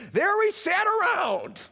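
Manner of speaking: lampooning the grumbling Israelites, high and slow; mocking nostalgic Israelites. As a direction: put on a wistful whiny ex-slave voice, savouring every word. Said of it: "Listen to the way they describe slavery".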